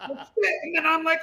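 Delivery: high-pitched retold alarm; mock panic. Driver spotting the turn too late, mock panic. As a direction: yelp in comic alarm.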